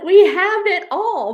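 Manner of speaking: high, loud, slow insistence; exasperated outburst. Retold or imitated. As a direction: drag out the frustration, loud and high. Arguing with border guards.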